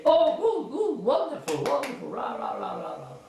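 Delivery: high, gushing, ending in rah rah cheers; cheering delight. Shown as a demonstration. Magician after the trick, cheering delightedly. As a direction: celebrate like a cheerleader.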